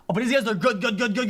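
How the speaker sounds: normal talking voice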